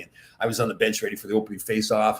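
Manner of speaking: ordinary delivery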